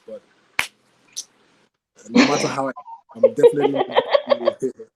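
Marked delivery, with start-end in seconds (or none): 2.1-5.0 laughing. Real, not acted. Laughing while teasing about face.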